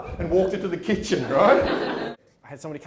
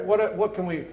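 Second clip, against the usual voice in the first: ordinary talk, nothing marked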